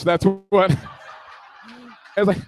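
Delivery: normal talking voice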